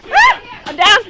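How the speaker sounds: loud high yelp of being hit; man down shout. Real, not acted. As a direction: yell for a medic after being hit. Hit in game, yells.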